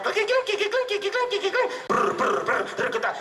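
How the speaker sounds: high tinkling strokes, then rolls; imitated drumming. Teller drums on the bull's horns, high clinking imitation. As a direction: tap out the horns with your voice. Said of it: "en los cachos".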